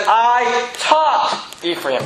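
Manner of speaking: taught drawn out slowly and falling; emphatic slow reading. Shown as a demonstration. Preacher stressing God's words for the congregation to underline. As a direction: read it slow, leaning on taught. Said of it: "you might wanna underline this".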